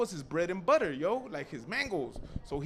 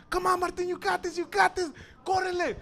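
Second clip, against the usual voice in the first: high-pitched, urgent rooting; root hard for a friend running from danger; cheering him on; 10 semitones higher, 3 dB louder than usual. Acted. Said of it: "we're all cheering him on".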